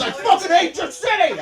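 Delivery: high-pitched mock rant; hammed-up outraged stranger; imitated angry confrontation. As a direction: get in his face, playing the hothead. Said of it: "he gets in my face".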